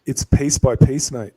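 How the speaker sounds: ordinary talk, nothing marked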